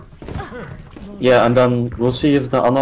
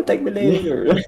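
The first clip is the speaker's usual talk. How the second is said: smug teasing; 6 semitones higher than usual. Pretend. Teasing teammate, drawn-out boast.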